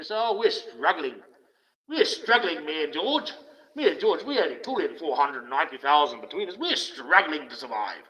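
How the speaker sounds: mocking a rich couple who claim to struggle, whiny parody. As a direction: parody a spoiled rich wife.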